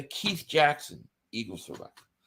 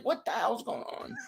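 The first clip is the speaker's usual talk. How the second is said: hushed shocked aside; 6 semitones higher, 9 dB quieter than usual. Retold or imitated. Retold shock at TV scene.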